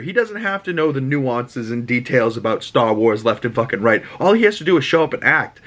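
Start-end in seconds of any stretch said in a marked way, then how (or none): none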